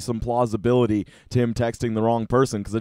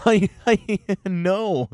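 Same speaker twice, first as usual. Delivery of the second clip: laughing; 8 semitones higher, 4 dB louder, much slower than usual. Real, not acted. Laughing while reading the reply.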